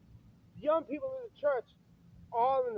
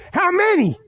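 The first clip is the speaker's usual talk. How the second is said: high, loud burst; angry shouted demand; 6 semitones higher, 12 dB louder than usual. Real, not acted. Man demanding how many churchgoers are born again, angry shout. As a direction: shout it as a challenge. Angry challenge to hypocrite congregation.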